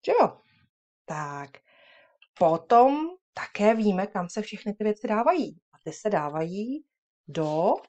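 ordinary talk, nothing marked

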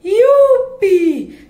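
high, loud gleeful cry; whee. Acted. Tico and the mouse float to the ground, gleeful. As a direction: squeal with joy while floating down.

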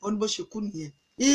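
plain reading voice

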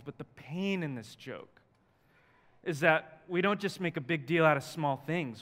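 normal talking voice

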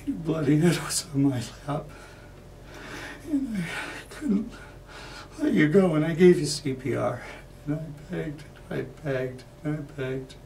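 high, halting, distraught telling; anguished grief. Acted. Man reliving holding dying Irene, anguished. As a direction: break it up as grief overtakes you. Acted account of her death.

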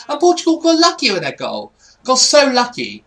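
high, loud, aggrieved outburst; indignant complaint. Real, not acted. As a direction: raise your voice in protest. Bitter about the opponent's goal.

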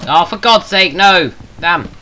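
loud, high exasperated cry; frustrated outburst. Real, not acted. Gamer yelling at the screen over a mishap. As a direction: yell at the game in real frustration.

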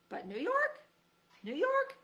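very high rising disbelief; incredulous. Acted. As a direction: squeak it out in disbelief. Fred insisting.

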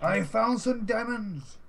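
excited. Real, not acted. Announces diamonds, high and drawn out.

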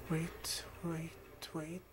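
quiet wait that sinks into a whisper; fading to whisper. Real, not acted. Gamer holding himself back near guards, fading to a whisper. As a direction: pull it down to a breath on each wait.

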